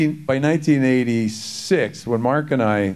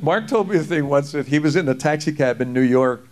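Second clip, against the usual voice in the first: ordinary talk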